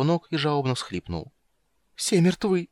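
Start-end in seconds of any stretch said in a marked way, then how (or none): 1.9-2.7 high, tearful whimper. Acted. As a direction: whimper it, near sobbing. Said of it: "прохныкал он". (прохныкал)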